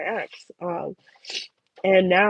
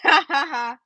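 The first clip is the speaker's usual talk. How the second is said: fake laugh; 7 semitones higher than usual. Laughing at his peg leg joke.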